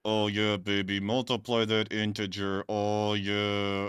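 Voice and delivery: hammed-up sultry voice; a touch of mock sexy talk; mock seductive. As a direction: purr at the math.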